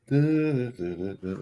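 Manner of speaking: normal talking voice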